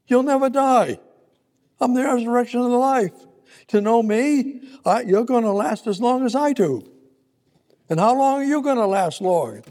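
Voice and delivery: fervent pulpit preaching; preaching at full force. Acted. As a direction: drive it home to the congregation.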